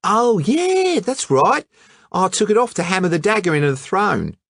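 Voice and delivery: breezy colonial lad; Hatchett's Australian voice. Acted. Hatchett answering the detective casually, brash Aussie voice.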